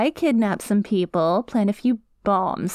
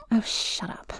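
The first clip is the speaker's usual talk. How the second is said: annoyed aside; 7 dB quieter than usual. Acted. Villain snaps at a hostage.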